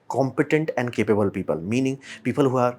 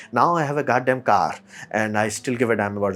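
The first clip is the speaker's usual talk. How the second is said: usual speaking voice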